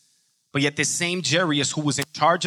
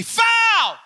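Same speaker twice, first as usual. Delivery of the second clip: high drawn-out shout; acted court-side scream; screamed foul call; 5 dB louder than usual. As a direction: yell it out like an indignant player. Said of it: "Or you scream at them".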